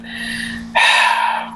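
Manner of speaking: long, voiceless exhale under pressure; heavy sigh. Retold or imitated. Pilot unsure what to do, acted heavy sigh. Retold moment of pressure as leader.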